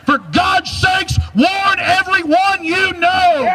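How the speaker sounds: slow, high, pleading shout; urgent shout. Real, not acted. Urgent plea to audience.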